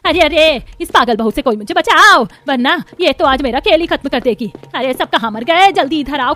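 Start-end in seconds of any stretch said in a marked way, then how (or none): 0.1-2.3 loud alarmed cry; calling for help. Acted. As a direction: call out loudly to be rescued. Chased mother-in-law calls for help.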